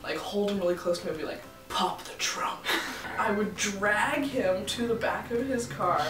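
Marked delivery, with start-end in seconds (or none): 1.7-2.6 whispered retold order; mock menacing whisper. Woman holding the driver really close, mock threatening whisper.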